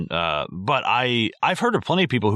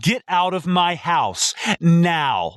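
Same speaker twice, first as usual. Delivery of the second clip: slow, higher menacing order, each word spaced out; John Malkovich; 8 semitones higher, much slower than usual. Pretend. Ordering the moron out of the house, slow and menacing. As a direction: drag it out like a coldly furious man. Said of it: "I would go John Malkovich on these motherfuckers".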